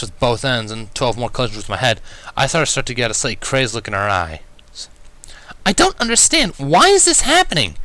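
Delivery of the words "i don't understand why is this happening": high, louder exasperated cry; baffled and fed up; rising frustration; frustrated. Acted. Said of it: "in obvious frustration".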